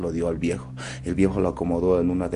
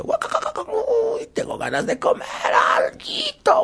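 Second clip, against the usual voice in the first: hungry fox voice; 3 dB louder, much slower than usual. Acted. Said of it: "tenía tanta hambre que decía".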